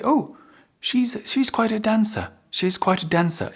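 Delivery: high, delighted exclaim; impressed exclamation. Shown as a demonstration. Teacher acting out admiring someone's dancing, high and delighted. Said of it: "you put emphasis on this".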